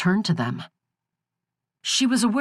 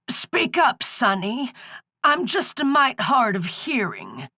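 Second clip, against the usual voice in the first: put-on high, bossy old crone; a cranky old woman scolding; 7 semitones higher than usual. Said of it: "came the old crone voice".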